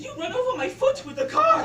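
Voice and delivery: high loud laughing yell; retold shout. Girl shouting at her mother about her foot, laughing yell.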